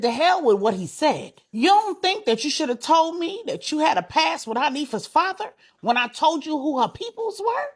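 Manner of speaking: high, loud, indignant questioning; angry confrontation. A narrator's reading. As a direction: demand an explanation from your wife. Husband quarrels with wife over past.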